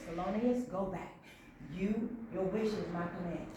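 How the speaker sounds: low, quiet granting voice; soft wish-granting. Acted. Princess Fish granting the security wish, soft and low. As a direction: say it gently low and hushed.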